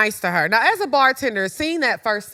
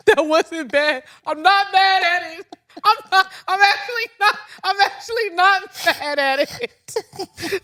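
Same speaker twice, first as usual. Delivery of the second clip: delighted praise; 9 semitones higher than usual. Real, not acted. Delighted reaction, laughing.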